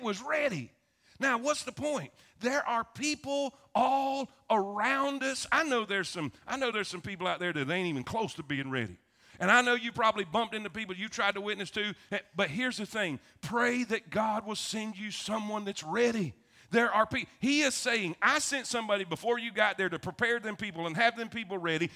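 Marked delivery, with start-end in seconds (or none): none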